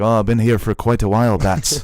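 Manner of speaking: light mock Irish accent on Superman's line; a hint of Irish brogue, hammed up. Superman answering Batman on an Irish hill, in a light mock Irish accent. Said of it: "you don't have to do accent".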